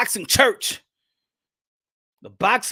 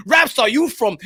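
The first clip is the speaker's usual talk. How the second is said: ordinary talk, nothing marked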